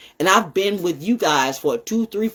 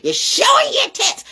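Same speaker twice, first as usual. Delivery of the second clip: much higher, pushed out on showing; mock shriek. Real, not acted. Mocking women online, a high exclaimed jab. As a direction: squeal it in disbelief. Same words shouted up high.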